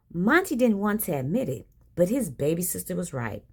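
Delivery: usual speaking voice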